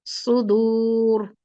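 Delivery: सुदूर very slow, heavy full र. Shown as a demonstration. Teacher recites सुदूर heavily for the students. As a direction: stretch सुदूर and end on a thick र. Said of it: "मोटा पढ़ देंगे".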